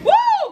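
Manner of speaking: very high, loud whoop; high celebratory squeal; excited whoop. Real, not acted. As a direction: whoop in triumph. Celebrates a critical hit.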